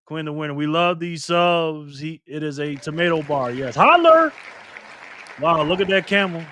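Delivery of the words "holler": excited call. Real, not acted. Hyped name call on stream.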